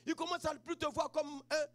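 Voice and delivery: ordinary delivery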